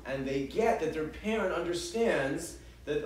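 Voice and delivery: normal talking voice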